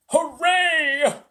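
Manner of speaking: high, loud triumphant whoop; shouted cheer. A narrator's reading. Old grasshopper cheering the capture, jubilant shout. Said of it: "shouted the old green grasshopper".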